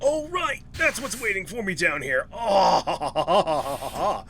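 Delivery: high, loud yelp of alarm tipping into laughter; rattled, laughing exclamation; startled recognition. Real, not acted. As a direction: blurt it out high and loud, then crack up. Startled by what waits in game.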